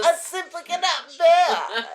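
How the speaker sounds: high, loud melodramatic lament; mock swoon in Southern; suffering Southern belle. Playing weary Southern gas pumpers.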